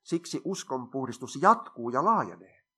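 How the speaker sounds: usual speaking voice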